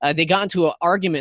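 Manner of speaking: usual speaking voice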